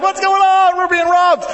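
hammed-up burglary panic; mock panic. Dad retelling his panic over a figure in the dark, shouting. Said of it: "I'm like".

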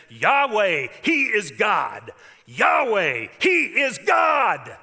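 loud, high, slow shout of awe; crowd's cry. Acted. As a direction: cry it out, stretching every word. People fall on faces crying out.

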